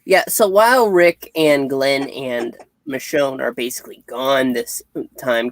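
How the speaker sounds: usual speaking voice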